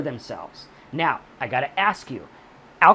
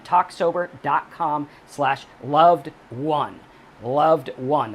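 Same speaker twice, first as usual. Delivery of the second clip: slow and louder, every word clear; spelled-out url; 4 dB louder, much slower than usual. Shown as a demonstration. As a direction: read the web address out slowly. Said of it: "That's loved, the number one".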